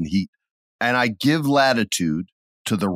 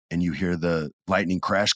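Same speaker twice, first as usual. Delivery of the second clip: ordinary delivery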